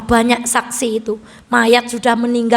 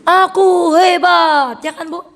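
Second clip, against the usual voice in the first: put-on proud child; 6 semitones higher, 3 dB louder, slower than usual. Child boasting quoted.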